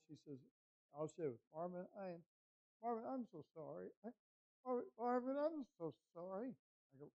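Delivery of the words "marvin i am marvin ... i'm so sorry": mock a weak, pleading phone voice; imitating distraught wife. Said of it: "her voice was like this".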